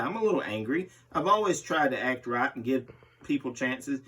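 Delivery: ordinary delivery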